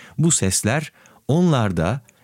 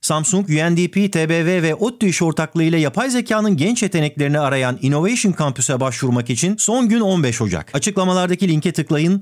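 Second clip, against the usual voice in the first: ad read; 6 semitones higher, faster than usual. Acted. Reads sponsor ad.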